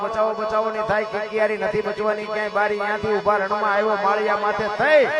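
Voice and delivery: chanted verse building from steady to loud and high; folk poem recitation. Acted. Storyteller reciting the quake poem to the dayro crowd, rising chant.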